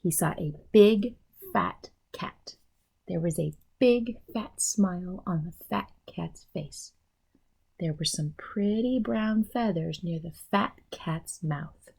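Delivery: ordinary delivery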